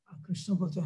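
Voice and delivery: plain reading voice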